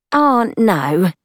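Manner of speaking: woman companion's voice. A narrator's reading. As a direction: lift the voice into a friendly female character.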